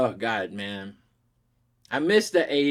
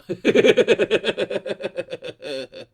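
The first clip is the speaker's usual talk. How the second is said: helpless breathy laughter, pitch up; high wheezy laugh; 7 semitones higher, 3 dB louder than usual. Real, not acted. Creator laughing at a rude comment. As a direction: let a wheezing laugh run out.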